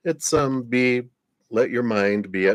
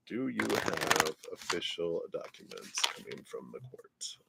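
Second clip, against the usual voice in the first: softer muttered reread; 13 dB quieter than usual. Real, not acted. Rereads question softly to herself.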